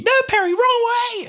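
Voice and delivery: high, loud protest; mock panicked yell. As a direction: shout a frantic correction. Acting Doctor on gurney again.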